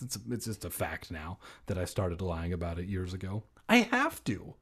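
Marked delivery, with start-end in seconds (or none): 3.7-4.5 cried. Acted. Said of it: "I cried".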